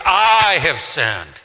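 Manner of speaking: slow, heavy "I have sinned"; chest-pounding confession, drawn out; "I have sinned" said slowly, leaning on "I". Shown as a demonstration.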